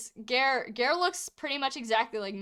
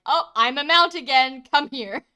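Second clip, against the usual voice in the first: eager; 3 semitones higher, 8 dB louder than usual. Acted. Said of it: "Jupiter attempts to worm".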